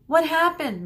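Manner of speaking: high, loud call across; shouted. Acted. Medusa shouting across the gorge, loud high call. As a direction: shout the question over the wind. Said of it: "Medusa shouted back".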